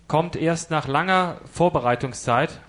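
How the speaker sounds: normal talking voice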